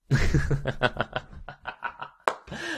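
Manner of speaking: loud burst of laughter; real breaking-character laugh. Real, not acted. Boss failing to keep a straight face, real laughing. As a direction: crack up mid-line. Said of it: "I don't think I could finish that with a straight face".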